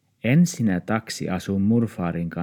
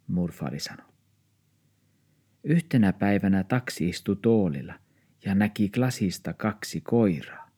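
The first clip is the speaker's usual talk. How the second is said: ordinary delivery, nothing marked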